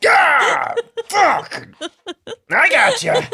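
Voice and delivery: hammed-up straining rescuer yells; mock heroic shouting. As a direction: heave through a pretend rescue. Acting out a clumsy choking rescue.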